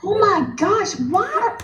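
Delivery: high-pitched, appalled outburst; shocked disbelief. Real, not acted. Friend hearing about a nasty comment, shocked disbelief. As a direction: react aghast to a mean comment.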